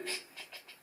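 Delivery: very soft, voiceless shushing; urgent "chut" with no voice; whispered hush. Acted. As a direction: hush the others while hiding. Patrol hushing each other while hiding.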